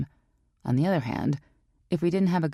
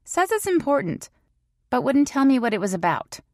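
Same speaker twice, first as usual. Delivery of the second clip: higher, louder female voice; serious female friend; 5 semitones higher, 4 dB louder than usual. A narrator's reading. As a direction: voice a serious, urgent girlfriend. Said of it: "Her serious tone".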